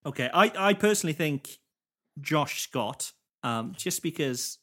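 normal talking voice

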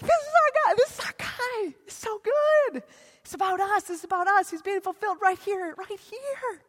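high, giddy chant of pride; giddy townsfolk. Retold or imitated. Nazareth crowd gushing about their boy, high and giddy. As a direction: gush with hometown pride.

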